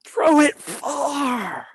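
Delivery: very high, long-stretched FAR; far-flung call. Acted. Acts out throwing far in poem.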